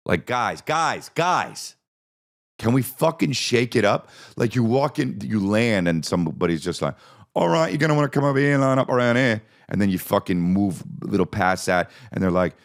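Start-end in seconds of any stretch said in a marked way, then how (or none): 0.3-4.1 high-pitched, pleading exasperation; mock exasperated plea. Mock plea to British people. 7.3-9.4 put-on quick, high London accent; British accent. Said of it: "to hear their accents".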